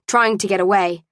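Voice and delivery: usual speaking voice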